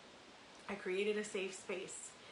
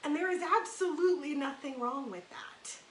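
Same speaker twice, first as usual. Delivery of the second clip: normal talking voice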